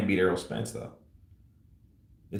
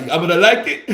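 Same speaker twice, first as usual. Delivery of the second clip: playing A J; 8 semitones higher, 8 dB louder than usual. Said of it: "impression of Errol Spence showing A J around".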